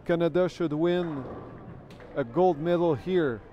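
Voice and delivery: normal talking voice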